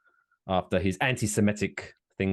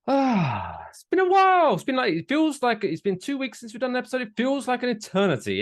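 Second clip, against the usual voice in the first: excited; 3 dB louder than usual. Real, not acted. Big high greeting.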